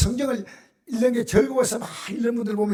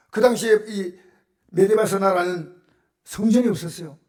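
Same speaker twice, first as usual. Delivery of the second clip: ordinary talk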